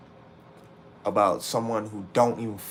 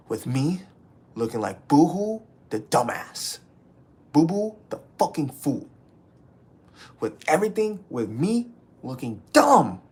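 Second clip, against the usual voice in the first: higher, louder, sneering at himself; bitter self-mockery; 5 semitones higher, 4 dB louder than usual. Real, not acted. Calling himself the fool to chat, bitter and mocking. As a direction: spit out the insult about yourself.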